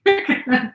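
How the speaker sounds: fail to hold in a laugh; suppressed laugh escaping. Real, not acted. Teacher trying to get through class, laugh slips out. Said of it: "without laughing".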